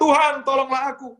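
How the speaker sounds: a woman crying out for help, acted by the preacher. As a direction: beg at the top of your voice. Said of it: "ketika dia berteriak".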